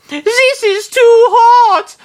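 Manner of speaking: piercing, drawn-out high shriek; yell. A narrator's reading. Nana burning from the curry, shrieking yell. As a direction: scream very high and loud, stretching it. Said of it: "Nana yelled".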